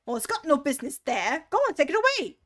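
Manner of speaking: loud impatient order; bossy rabbit. Acted. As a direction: order him off, rising high.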